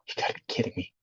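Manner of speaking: gasping whisper of disbelief. Real, not acted. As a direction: breathe it out in shock. Lost his spot again.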